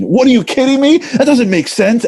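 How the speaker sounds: high, heated mock yelling of a scholar; mock yeshiva argument. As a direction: throw the objection back like a fired-up study partner. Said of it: "adult men yelling at each other".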